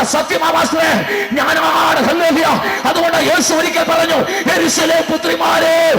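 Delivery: preacher calling the church to weep for their families; preaching at full force. Acted. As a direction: hammer each line at the congregation. Preacher exhorting the congregation.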